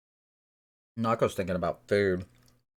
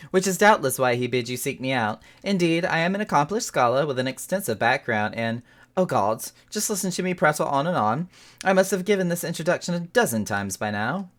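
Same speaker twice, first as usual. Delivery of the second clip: chatty scholar voice; 6 semitones higher, 4 dB louder than usual. Acted. Reads NPC scholar's lines.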